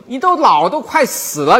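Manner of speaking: stern master scolding a disciple. Retold or imitated. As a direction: berate him for wasting his years. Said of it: "狠狠地骂了一顿".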